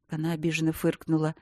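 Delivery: ordinary delivery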